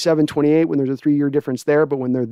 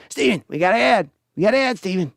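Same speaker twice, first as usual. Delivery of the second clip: Jerry voice; 4 semitones higher than usual. Acted. Father voice in skit.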